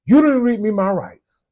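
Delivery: lightly voiced indignant arrestee; mock complaining suspect. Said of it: "everybody always say".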